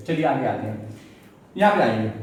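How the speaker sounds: normal talking voice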